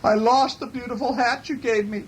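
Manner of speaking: high storybook bear voice; calling bear. A narrator's reading. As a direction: call up to the moon in a high voice. Bear calls to the moon.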